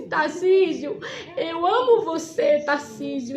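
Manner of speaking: tearful declaration. Retold or imitated. Girl telling her imprisoned father she loves him, tearful. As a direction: say it through tears and laughter.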